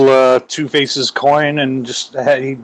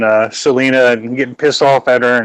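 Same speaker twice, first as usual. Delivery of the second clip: normal talking voice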